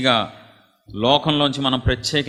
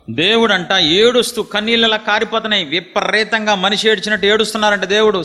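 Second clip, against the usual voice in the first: high, loud impassioned preaching; fervent preaching; 8 semitones higher, 4 dB louder than usual. Acted. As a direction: pour your heart out to the congregation. Preaching God's weeping. (ఉద్వేగంతో గట్టిగా ప్రసంగం)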